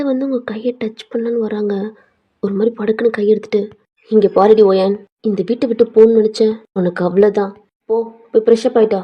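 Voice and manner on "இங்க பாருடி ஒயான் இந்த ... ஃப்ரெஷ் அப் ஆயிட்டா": loud, fast, stern telling-off; mother glaring, cutting her off firmly; stern scolding. Acted. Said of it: "முறைச்சு சொல்றாங்க". (மாமியார் முறைத்துக் கண்டிப்பான குரலில்)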